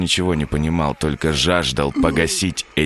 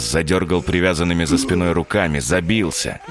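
plain reading voice

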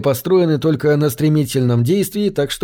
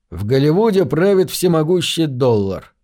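plain reading voice

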